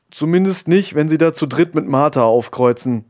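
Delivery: a touch of huffy annoyance; grumpy. A narrator's reading. Friend answers the jab about her parents, grumpily. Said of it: "sagte Wema grantig".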